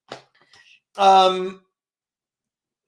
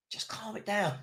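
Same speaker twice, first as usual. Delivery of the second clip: much softer, almost to himself; soft repeated plea; 2 semitones lower, 13 dB quieter than usual. Real, not acted. Fan begging his team to settle, said softly to himself. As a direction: say it under your breath to the team. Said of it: "why don't we just calm it down".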